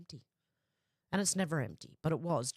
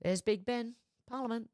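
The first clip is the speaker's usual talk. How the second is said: movie quote; 5 semitones higher than usual. Retold or imitated. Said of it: "The only thing I remember from the National Lampoons".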